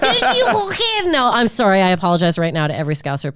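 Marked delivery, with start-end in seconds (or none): 0.0-1.1 put-on higher, slow second go at a Scouse accent. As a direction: push the Scouse accent up high. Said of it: "Never do that again".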